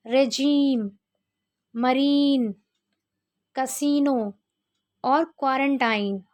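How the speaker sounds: i said as a long ई, as in रेजीम; मरीन, रेजीम with a long ई; example words read out. Shown as a demonstration. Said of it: "आई का उच्चारण बड़ी ई".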